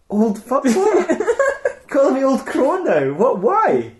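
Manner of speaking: put-on an indignant old witch shrieking back; laughing, high-pitched disbelief; offended. Witch offended at being called crone.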